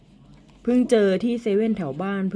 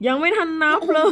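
laughing; 10 semitones higher, 3 dB louder than usual. Real, not acted. Mother teasing during the count.

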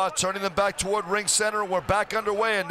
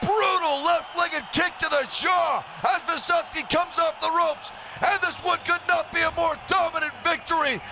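excited play-by-play; 7 semitones higher than usual. Real, not acted. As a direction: call the knockout at full hype. Commentator calls the knockout.

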